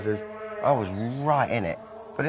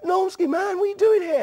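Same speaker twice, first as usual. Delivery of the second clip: much higher, louder surprised call; surprised greeting; 6 dB louder than usual. Retold or imitated. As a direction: mimic a friend spotting you, delighted and amazed. Jazzy greets him, surprised.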